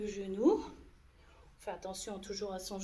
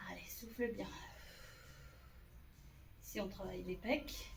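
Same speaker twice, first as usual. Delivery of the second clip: whispered; 3 semitones lower, 12 dB quieter than usual. Real, not acted. Coach exercising, breath held.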